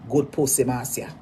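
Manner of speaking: normal talking voice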